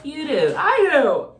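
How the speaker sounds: high, loud answer; loud retort. Pretend. Reader answering the pretend "who cares", loud and high. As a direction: shout back the answer.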